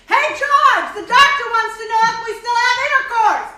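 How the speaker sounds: calling out loud to her husband in another room; yelling out. Retold or imitated. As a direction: yell it across the waiting room to George. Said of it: "yells out".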